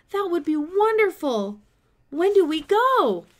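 thrilled. A narrator's reading. Boy asking the doctor eagerly, thrilled and loud. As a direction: gush with eager excitement.

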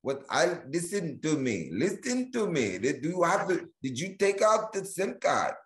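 hammed-up Indian tech-support accent; attempted Indian accent. Tech support agent to stranded customer, in a mock Indian accent. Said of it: "some Indian person saying".

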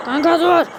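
sharp yell, pitch jumping up; loud panicky yell to a friend; yelling stop. Acted. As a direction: shout at your buddy to stop.

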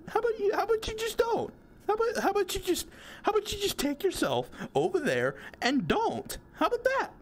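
high, fast sassy retort; high sassy telling-off. Real, not acted. Player sasses the ghost to go away, high and fast.

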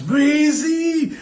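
high, far-off calling out a nickname; excited greeting. Retold or imitated. [name] greeting [name], high excited call. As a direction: call out with open arms.

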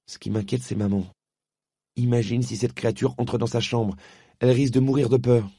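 put-on boy afraid for his sick mother; worried boy. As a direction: let the fear for mum creep in. Diggory fears for mother.

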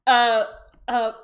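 ordinary talk

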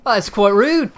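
high, loud mock-British indignation; hammed-up offended Brit; British accent. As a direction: put on an exaggerated British accent. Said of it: "my favorite British-ism".

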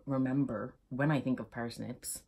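normal talking voice

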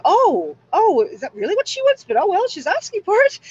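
high mock-pitying voice, oh well; mocking universe voice. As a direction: voice the universe as a smug gossip. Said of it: "and they go".

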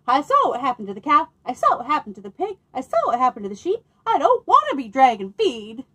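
fast, loud, rattled-off fear; the dog begs not to be eaten; panicky dog. Acted. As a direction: gabble it out in a scared rush. Frightened dog protests.

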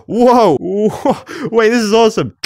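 high, loud delighted whoa; amazed excitement. Real, not acted. Player discovering archery, thrilled whoa. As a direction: gasp then cheer.

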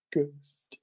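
hushed spooky whisper on one word. Acted. Game master hushes the player on ghost, whispering. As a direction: breathe the word out like a secret.

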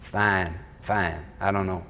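low, soft lazy Texas drawl; a hint of a Texan drawl, low and quiet. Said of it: "he had that Texas, Texan drawl".

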